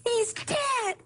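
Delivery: high, slow drawn-out scream of grief; retold scream. Mother screaming the news down the phone to her daughter. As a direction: cry out the news as loud as possible. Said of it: "she screamed as loud as she could".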